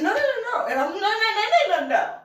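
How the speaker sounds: high, loud hammed-up "no no no"; playful mock refusal to a boyfriend; mock protest. Refusing the bite, quoted protest.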